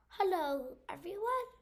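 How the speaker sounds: sing-song high greeting like a cartoon host; mock game host. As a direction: greet the viewers in a put-on high voice.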